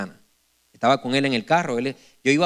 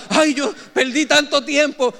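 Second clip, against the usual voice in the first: mock lament; 10 semitones higher, 3 dB louder, slower than usual. Mocking those who feel old.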